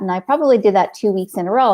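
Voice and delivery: ordinary talk, nothing marked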